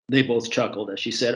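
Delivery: ordinary delivery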